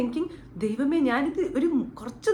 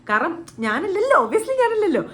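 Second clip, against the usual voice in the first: emphatic; 7 semitones higher, 5 dB louder than usual. Real, not acted. Insisting it's not her.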